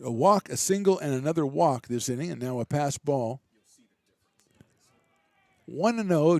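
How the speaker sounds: brisk, lively baseball call; live play-by-play. Real, not acted. Radio announcer calling the inning for listeners, lively play-by-play. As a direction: keep the game moving for listeners.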